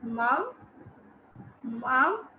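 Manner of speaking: "Mom" held alone, then "stir" after a pause; split into syllables. Shown as a demonstration. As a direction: isolate each sound: MOM... STIR. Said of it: "Please focus on the sound".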